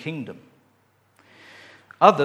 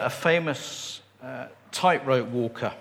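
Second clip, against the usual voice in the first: normal talking voice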